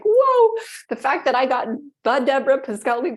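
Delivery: high, loud amazed whoa. Retold or imitated. Guest recalling her luck, amazed whoa. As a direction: gasp out a stunned whoa. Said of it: "And I'm like".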